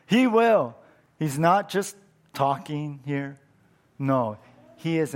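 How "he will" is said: higher, louder and triumphant; emphatic answer. Acted. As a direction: land the promise with a grin. Repeats the punchline after laughing.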